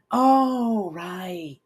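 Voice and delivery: sudden realisation. A narrator's reading. Pinky realises her mistake.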